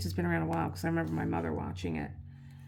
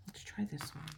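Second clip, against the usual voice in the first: whispered to herself; a quiet aside while working; 4 semitones higher, 10 dB quieter than usual. Real, not acted. Crafter whispering to herself while swapping tips.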